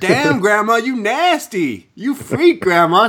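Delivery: high mock-scandalized heckling; hammed-up crowd catcall; teasing heckle.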